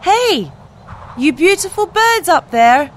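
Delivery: calling out. Acted. Duckling shouting up to the beautiful birds, high and loud. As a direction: call up to the swans. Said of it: "shouts he suddenly".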